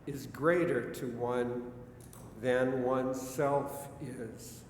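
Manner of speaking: poetry recitation. Acted. Reads Whitman poem aloud.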